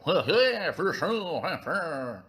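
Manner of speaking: wordless French honking, high and loud; exaggerated French noises that swoop up and down; mock French accent. Shown as a demonstration. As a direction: caricature French grunting, no real words. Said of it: "My French accent is, uh, slightly insulting".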